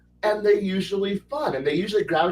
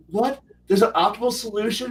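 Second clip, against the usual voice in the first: usual speaking voice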